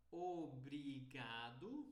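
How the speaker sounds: slow "obrigado", every vowel open; Brazilian pronunciation. Shown as a demonstration. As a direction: draw out each syllable of obrigado, Brazilian style. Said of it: "Esta é a pronúncia brasileira".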